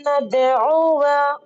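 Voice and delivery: नदउवा recited very slowly, drawn out; slow tajweed demo. Shown as a demonstration. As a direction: show the class how to recite it. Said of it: "इस तरह से पढ़ा जाएगा". (नदउवा धीमी तिलावत)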